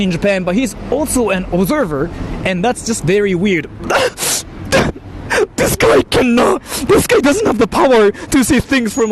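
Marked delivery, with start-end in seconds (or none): 3.7-5.5 high, airless wheeze of laughter; real helpless laughter; wheezing laugh. Real, not acted. Laughing at the video. 5.6-9.1 real laughing outrage; laughing shout. Real, not acted. Laughing rant at the commenter.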